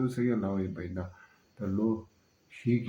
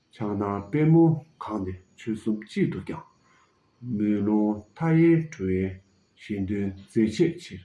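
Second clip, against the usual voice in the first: louder, measured declaiming with pauses; formal recitation; 7 dB louder than usual. Acted. Speaker reciting a long text to listeners, loud measured declaiming. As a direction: recite it like a formal speech, line by line.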